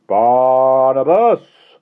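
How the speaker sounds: very slow, loud, high stretched call of the name; drawn-out call. Acted. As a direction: draw the name out like a lure. Villain calls the boy again.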